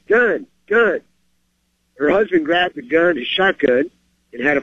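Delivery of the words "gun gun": Gun! Gun! pitched higher as a read-aloud cry; mild narrated yell. A narrator's reading. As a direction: lightly act out the wife's alarmed call. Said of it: "the store owner's wife ran to the back yelling".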